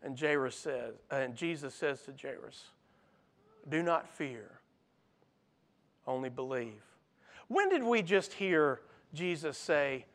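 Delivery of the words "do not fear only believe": soft, low, gentle promise; quietly steady a frightened father; tender reassurance. Acted. Jesus comforts grieving father.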